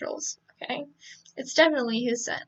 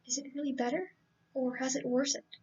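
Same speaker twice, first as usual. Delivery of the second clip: muttering under his breath; 7 dB quieter than usual. A narrator's reading. Man turned away from her, muttering under his breath. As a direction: mutter it softly to yourself. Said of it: "mutter under his breath".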